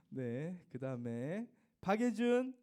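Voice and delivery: normal talking voice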